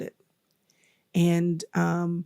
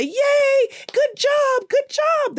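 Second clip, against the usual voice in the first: put-on high, louder cheering at a fallen toddler; cheering grandmother; 4 dB louder, much slower than usual. Grandmother cheering a toddler who fell, high and excited. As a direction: cheer the child up high and bright. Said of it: "if you cheer and say".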